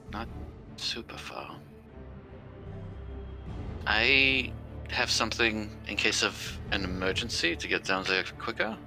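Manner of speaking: usual speaking voice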